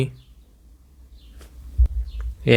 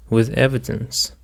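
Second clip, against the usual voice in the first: ordinary delivery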